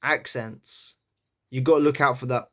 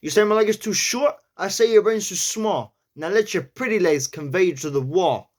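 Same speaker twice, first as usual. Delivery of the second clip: New York accent; 6 semitones higher, 3 dB louder than usual. Shown as a demonstration. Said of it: "going through it and analysing".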